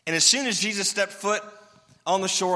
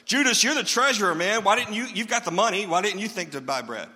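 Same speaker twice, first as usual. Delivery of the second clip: loud, high, squabbling blame; bickering disciples; 5 semitones higher, 4 dB louder than usual. Retold or imitated. Disciples blaming each other for forgotten bread, loud bickering.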